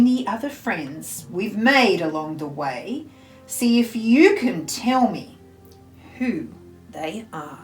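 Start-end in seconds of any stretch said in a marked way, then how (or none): none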